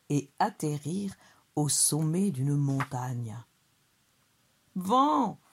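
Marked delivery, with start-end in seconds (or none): 4.7-5.4 high, drawn call to the wind; crying out. A narrator's reading. Souriceau on a mountain top, crying out high. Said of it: "s'écria le souriceau".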